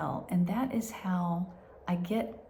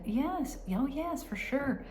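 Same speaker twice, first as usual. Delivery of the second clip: lightly voiced technician, higher pitch; a hint of a higher, reassuring voice; 8 semitones higher than usual. Technician confirming results to anxious patient, lightly voiced reassurance.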